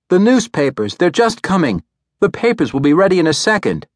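high, bright-voiced young newsgirl; chirpy kiosk girl. A narrator's reading. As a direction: call it out high and quick. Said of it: "announced the soprano".

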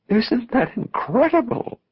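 high, slow marvelling; awestruck, high-pitched exclamation; amazed. A narrator's reading. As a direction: exclaim in wonder, stretching the words. Amazed at vision in the clouds.

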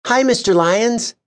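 high, friendly rising hello; young woman greeting warmly; kind greeting. A narrator's reading. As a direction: greet him with a kind smile. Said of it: "smiling, with a kindness".

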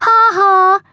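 high, loud, spoken ha-ha; gloating schoolboy laugh; gurgling mock laugh. A narrator's reading. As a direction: crow it mockingly. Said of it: "gurgled Nick".

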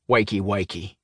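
grating Cockney wake-up call; Cockney accent. A narrator's reading. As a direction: taunt it in Cockney. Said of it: "in a grating Cockney accent".

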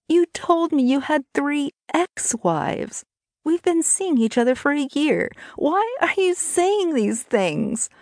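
high-pitched, distraught woman's voice; hurt, desperate pleading. Acted. Brittany confronts her lying lover.

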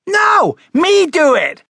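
very high, loud tantrum scream. Acted. Frederick refusing help onto the trampoline, screamed protest. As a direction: scream it like an insistent small child. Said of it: "screamed Frederick".

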